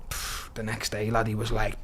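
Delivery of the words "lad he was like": ordinary talk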